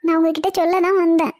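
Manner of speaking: usual speaking voice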